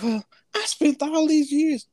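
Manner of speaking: lightly mocking whine; mock whiny complaint. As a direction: imitate a woman lamenting wasted years. Mimicking women after breakup.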